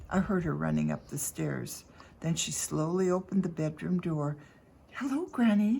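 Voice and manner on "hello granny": ordinary delivery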